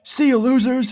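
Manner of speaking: put-on taunting kid.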